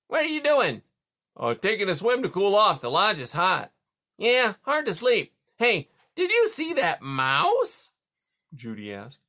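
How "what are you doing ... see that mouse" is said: higher, louder gruff beaver voice; a sleepy beaver calling across the pond. Acted. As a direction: beaver asking who's there, pitched up. Beaver dialogue.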